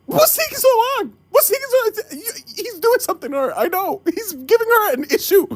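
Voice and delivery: high, fretting about the delay; anxious worried rant. Retold or imitated. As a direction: panicky pacing worry. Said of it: "I'm like".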